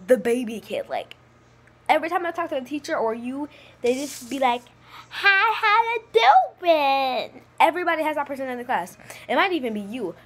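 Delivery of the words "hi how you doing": put-on high, loud little-kid greeting; three-year-old voice. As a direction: imitate an overgrown toddler saying hello. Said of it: "they sound like a three-year-old".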